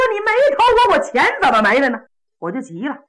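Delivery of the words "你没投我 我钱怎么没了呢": much higher and louder, then rushed; angry retort. Retold or imitated. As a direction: snap back at the cousin, fast. Angry quarrel with cousin. (表弟急了冲表哥大喊)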